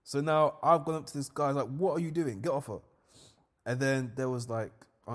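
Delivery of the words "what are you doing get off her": higher, louder challenge; retold confrontation. Confronting the seller over the friend, higher loud mock challenge. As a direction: square up to the seller.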